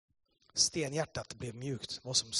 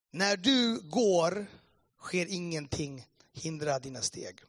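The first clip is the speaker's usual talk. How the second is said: drawn-out, solemn reading of the verse; slow scripture reading; 3 semitones higher, slower than usual. Real, not acted. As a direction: read each word slowly. Preacher reads a Bible verse. (långsam, högtidlig bibelläsning)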